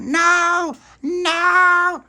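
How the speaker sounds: high, loud screamed no; terrified scream. Acted. Dolores grabbed by Pauline, terrified loud no. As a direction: scream no as you're caught. Caught by the vampire.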